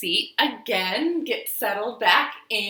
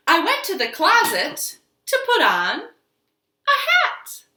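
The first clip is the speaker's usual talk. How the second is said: higher and louder, bouncing storybook voice; reading to children; 4 semitones higher, 4 dB louder than usual. Acted. Teacher reading I Made a Mistake to children, playful and lively. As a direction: ham up each mistake for the kids.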